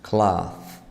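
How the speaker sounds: "Cloth" with a long, breathy th at the end; exaggerated th practice. Shown as a demonstration. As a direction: stretch the final th of "Cloth".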